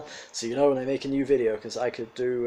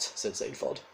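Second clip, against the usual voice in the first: plain reading voice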